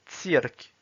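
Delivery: "Cirque" as Russian "tsirk"; Russian pronunciation. Shown as a demonstration. Teacher reading words with learners, "Cirque" in Russian. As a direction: read "Cirque" in Russian, starting "ts". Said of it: "s'entraîner à lire les mots".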